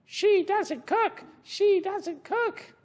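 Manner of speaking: squawky high parrot, slow. Acted. Teacher reading the parrot's line to kids, high parrot squawk. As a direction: chirp it like a parrot.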